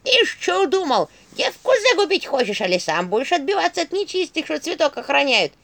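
high, loud scolding staff voice; Черепуша. A narrator's reading. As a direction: scold him at a high, forceful pitch. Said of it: "что она разговаривает". (сварливый посох, высоко и громко)

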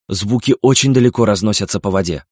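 usual speaking voice

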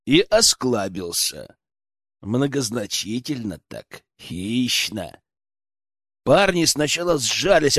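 ordinary delivery, nothing marked

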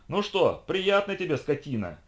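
high, gloating shout. A narrator's reading. Professor jeering at the trapped robot, shouting. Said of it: "выкрикивал он".

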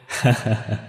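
short acted chuckle; pleased storyteller's character laugh; hearty old man's laugh. A narrator's reading. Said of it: "cười rôm rả của ông".

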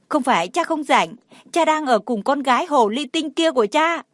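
put-on high, indignant little-boy voice; acted childish anger; angry child. A narrator's reading. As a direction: accuse dad like a cross child. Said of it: "Thuyên Thuyên tức giận quay mặt".

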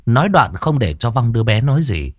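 plain reading voice

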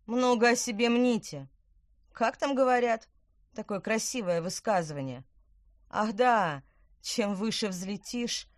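loud, high, sneering taunts; a smug villain mocking his victim; taunting villain. A narrator's reading.

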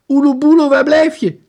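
put-on high, loud, urgent boy's cry; boy calling. Boy on pirate TV calling his friend, high urgent cry.

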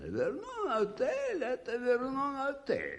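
high, lofty pronouncement of the hotel's name; pompous pride. Acted. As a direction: savour the name like a sacred institution. Чопорная гордость клубом. (высокий напыщенный тон, с гордостью за отель)

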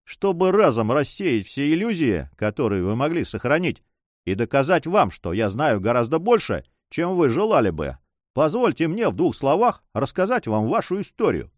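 usual speaking voice

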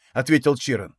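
ordinary delivery, nothing marked

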